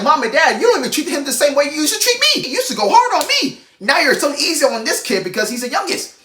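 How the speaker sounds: mock the jealous firstborn; older kid whining to parents; whiny sibling complaint. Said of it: "and you're like".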